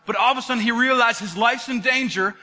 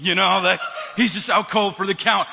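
mocking, laughing through the words; laughing taunt; 3 semitones lower than usual. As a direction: chuckle while teasing them.

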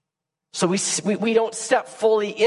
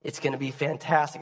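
soft, tender voice of God; 4 semitones lower, 5 dB quieter than usual. Acted. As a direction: speak gently as a loving God. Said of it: "God said".

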